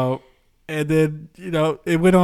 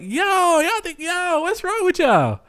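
higher, slow, mock-outraged yo what's wrong with y'all; exasperated disbelief; slower than usual. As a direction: drag it out in fake outrage. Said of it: "I was like".

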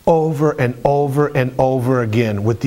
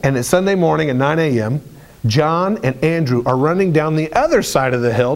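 ordinary delivery

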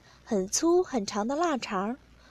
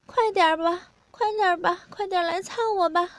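high put-on partner's voice; acted high-pitched urging; urgent pleading; 7 semitones higher than usual. A narrator's reading. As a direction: plead in a higher voice, hurrying at the end.

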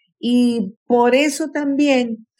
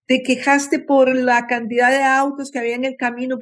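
ordinary talk, nothing marked

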